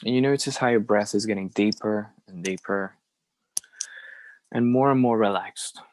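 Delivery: unhurried phrases with very long silences; guided meditation. Acted. Teacher leading a group through belly breathing, guided meditation. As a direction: guide each breath, leaving room to follow.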